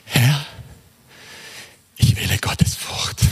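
hushed, low retelling of an outcry; subdued, halting vow; retold tearful cry. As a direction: recall the tearful choice in a near-whisper. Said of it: "in Tränen aufgelöst schrie ich auf".

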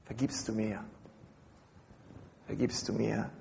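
quiet, gentle imitated forgiving reply; a hushed, tender mock reply to a plea for forgiveness; softly voicing the partner. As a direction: murmur it softly, like a spouse who has just forgiven. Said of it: "bis er sagt".

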